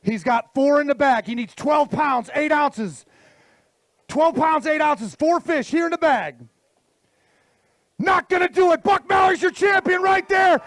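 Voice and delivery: builds to a high shouted champion call; announcer calling the result. Acted. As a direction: rouse the crowd for the winner.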